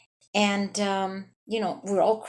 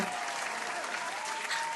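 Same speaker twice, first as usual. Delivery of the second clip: squealing silent laugh; high breathless cackle; 10 dB quieter than usual. Real, not acted. Recalling weirdos in elevator, high squealing laugh. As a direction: crack up, high and airless. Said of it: "I was cracking up".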